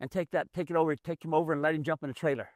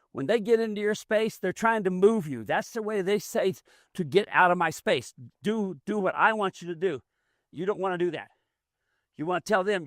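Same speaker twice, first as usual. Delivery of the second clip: ordinary talk, nothing marked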